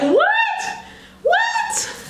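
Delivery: very high, disbelieving squeal; incredulous squeal. Real, not acted. Wig reviewer amazed at the length, incredulous squeal.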